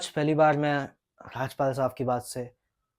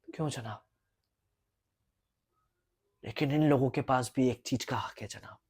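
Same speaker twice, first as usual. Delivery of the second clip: sudden whisper; 8 dB quieter than usual. Acted. Lawyer whispers to the judge, hushed and pointed.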